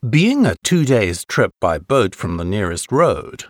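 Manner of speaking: normal talking voice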